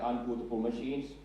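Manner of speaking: usual speaking voice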